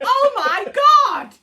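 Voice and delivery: very high, loud, slow excited shriek; excited scream. Retold or imitated. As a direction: squeal with disbelieving joy. Reacting to date agreed.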